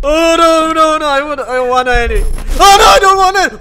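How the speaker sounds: high, loud panicked pleading; screaming in real panic. Real, not acted. Gamer panicking at a jump scare, loud frightened screams.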